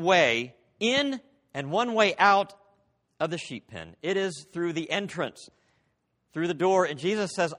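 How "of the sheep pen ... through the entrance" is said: ordinary talk, nothing marked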